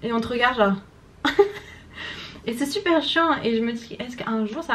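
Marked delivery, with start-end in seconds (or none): 1.2-1.7 quick high burst of laughter; brief real giggle; short laugh. Real, not acted. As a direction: laugh at yourself. Laughing at looking silly.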